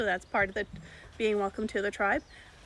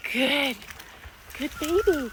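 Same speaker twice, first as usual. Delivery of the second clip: praising a monkey; 4 semitones higher, slower than usual. Real, not acted. Praising the monkey who took food, high coo. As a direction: coo it like to a pet.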